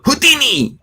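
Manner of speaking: high-pitched little alien cry; Jawa. Retold or imitated. Host mimics the podcast's bleep, high Jawa squeal. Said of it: "have a Jawa go".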